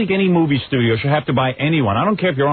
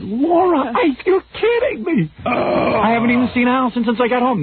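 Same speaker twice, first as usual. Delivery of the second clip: much higher, disbelieving outburst; frantic boss to assistant, much higher; retold panic; 11 semitones higher than usual. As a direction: squeak out shocked disbelief. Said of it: "I said".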